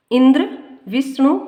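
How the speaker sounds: इं in Indra and वि in Vishnu punched, ending on a rise; stress on first syllable. Shown as a demonstration. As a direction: stress the opening of Indra and Vishnu, lifting at the end. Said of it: "ई और वी पर जोर".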